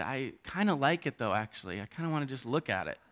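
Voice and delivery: put-on hushed, secretly fascinated child; timid little kid. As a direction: confess a shy fascination, very softly and low. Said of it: "as a little kid".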